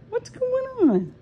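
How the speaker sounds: very high, slow and sing-song; squeaky pet talk. Real, not acted. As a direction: ask the cat in a squeaky voice. Higher, slower repeat to the cats.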